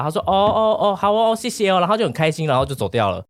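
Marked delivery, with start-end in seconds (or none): 0.2-1.8 higher grandma voice, pleased thanks; lightly imitated pleased grandma. Grandma takes the autograph and thanks him, mild grandma impression.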